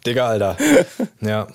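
laughing through the words; amused, giggling slang. Real, not acted. Laughing at their own youth slang.

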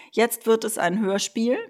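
ordinary talk, nothing marked

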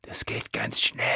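secretive conspiring whisper. Acted. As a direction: whisper the plan so no one hears. Dragon mother conspires secretly.

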